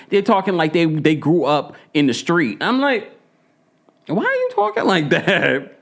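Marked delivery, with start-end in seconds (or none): 4.1-5.7 high baffled question; high exasperated question; incredulous. Retold or imitated. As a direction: ask it like you can't believe your ears. Reacting to celebrity kids' slang.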